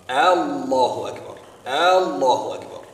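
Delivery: long, loud opening A in "Allahu"; drawn-out first vowel. Shown as a demonstration. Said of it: "they elongate the very beginning".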